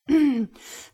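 loud, pointed throat clear; emphatic "ahem" without words. Acted. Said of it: "Gott räuspert sich".

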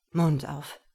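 low, loud, brief order; commanding. A narrator's reading. Man orders hesitant woman, low and commanding. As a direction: command with full authority. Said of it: "befahl er".

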